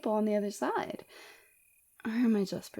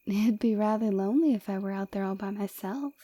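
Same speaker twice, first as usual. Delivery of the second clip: normal talking voice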